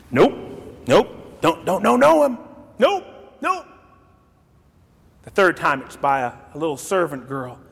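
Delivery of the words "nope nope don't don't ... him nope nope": quick, jittery nope-nope; panicky denial. Retold or imitated. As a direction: brush off the question nervously. Peter denying knowing Jesus.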